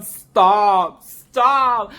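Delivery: loud, falling pleas of stop; loud pleading stop. Real, not acted. Reviewer yelling at the officer showing his wound, loud pleading. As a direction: beg the screen to make it stop.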